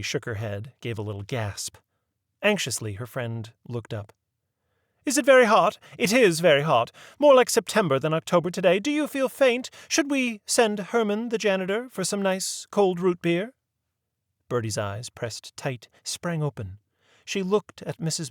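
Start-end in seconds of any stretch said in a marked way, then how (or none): 5.0-13.5 higher, fussy genteel lady; a proper old lady leading the inquiry; prim lady voice. A narrator's reading. Mary Mabel fusses over Birdie.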